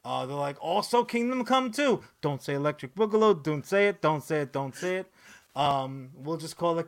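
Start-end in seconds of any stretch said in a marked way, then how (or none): 0.6-2.0 higher, louder mocking voice; mocking quote. 2.2-5.1 mock pleading. Real, not acted. Pleading with himself, repeated.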